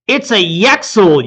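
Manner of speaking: slow, loud alarmed cry. A narrator's reading. Hero spotting the Yexel swoop in, alarmed cry. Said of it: "you cry".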